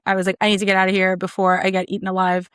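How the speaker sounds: normal talking voice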